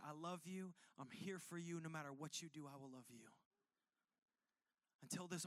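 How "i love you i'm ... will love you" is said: softer, lower, gently reassuring; tender reassurance. Retold or imitated. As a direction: soothe a raging child with calm love. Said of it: "hold her in her arms and say".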